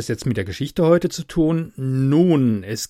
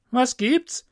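much higher, quick question; innkeeper asking; 11 semitones higher than usual. Acted. Innkeeper answers the dusty rider, high questioning voice. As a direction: ask what the rider wants.

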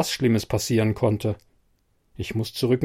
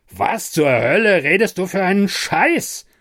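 louder and higher, an angry rebuke; snapping angrily; 7 semitones higher, 4 dB louder than usual. A narrator's reading. Bernard snapping at his confused colleague, angry. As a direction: snap at him in disbelief. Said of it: "fährt mich Bernard an".

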